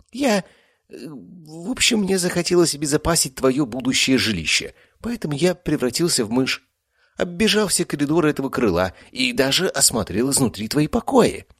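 high, hesitant cat voice; a sheepish tomcat making excuses; cat werewolf voice. A narrator's reading. Cat explains himself. (высоко, смущённо, как кот)

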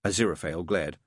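usual speaking voice

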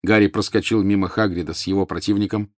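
plain reading voice

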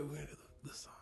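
whispered aside; whispering. Real, not acted. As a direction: whisper it secretly.

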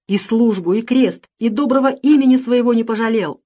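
high, loud angry reproach; outraged scolding, pressing on; indignant tirade. A narrator's reading. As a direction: berate a betrayer of trust. Master berates thieving servant.

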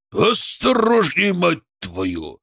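high, drawn-out warning dropping into a curse; angry yell. A narrator's reading. Colleague yells at driver through partition, angrily. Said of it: "заорал Лемигов".

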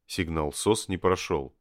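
plain reading voice

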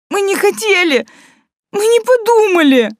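very high crying plea; high tearful plea. A narrator's reading. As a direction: beg through tears, voice up high. Sobbing girls beg.

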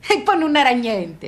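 usual speaking voice

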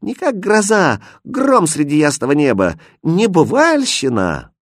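acted frightened exclaiming; alarmed cry. A narrator's reading. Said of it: "закричали девушки".

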